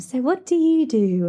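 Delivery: mock a calm networking guest, lightly; serene networker. Mimicking serene guests at a networking event, lightly. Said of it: "everyone's, like, serene and".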